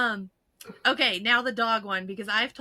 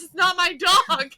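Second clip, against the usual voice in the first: quoting through tears of laughter; laughing quote; 5 semitones higher, 7 dB louder than usual. Retold or imitated. Friend retelling the kid's line, laughing hard. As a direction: crack up while saying it.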